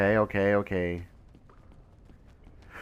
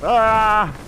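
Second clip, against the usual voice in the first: sudden loud scared yelp; startled scream; 8 semitones higher, 3 dB louder than usual. Real, not acted. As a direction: jump out of your skin. Monster appears suddenly.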